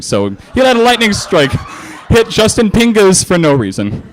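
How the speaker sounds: plain reading voice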